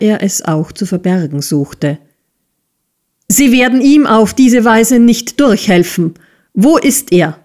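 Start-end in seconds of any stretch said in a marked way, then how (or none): 3.3-7.4 higher, irate accusation; raised, rattled and angry; agitated shouting. A narrator's reading. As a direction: press hard, demanding an answer. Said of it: "schrie Squeers, sichtlich beunruhigt".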